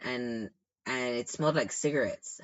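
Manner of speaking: ordinary delivery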